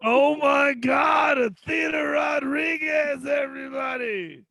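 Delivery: high, drawn-out announcer shout; hype host shout. Real, not acted. Host applauding Athena Rodriguez after her set, long excited shout. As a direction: belt out the name slowly for applause.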